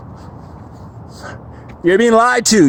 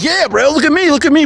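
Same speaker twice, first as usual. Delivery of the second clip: mock show-off bro; 6 semitones higher than usual. Mocking a gym bro showing off.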